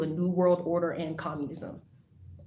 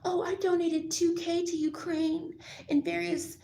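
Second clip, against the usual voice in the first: lightly mocking higher voice, slowed; mocking quote; 11 semitones higher than usual. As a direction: imitate someone bragging, a little slowly. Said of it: "Chief Handler tells me".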